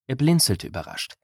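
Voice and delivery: ordinary delivery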